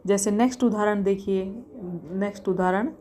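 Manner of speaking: normal talking voice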